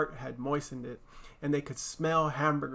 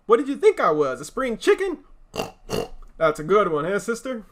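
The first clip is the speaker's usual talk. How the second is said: a hint of a boisterous pig, high and loud; a big pig laughing at his own joke; lightly voiced loud pig; 6 semitones higher, 7 dB louder than usual. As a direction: crack the joke like a loud braggart. Boastful pig joking loudly.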